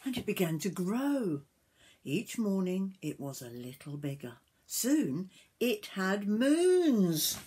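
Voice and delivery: sing-song reading building to a reveal; story narration to children. A narrator's reading. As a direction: stretch the last word in wonder.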